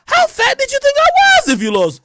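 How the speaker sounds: high, loud disbelieving squeal; indignant outburst. Retold or imitated. Answering a friend's compliment, high outraged squeal. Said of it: "I probably gained five pounds".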